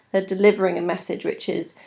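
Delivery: normal talking voice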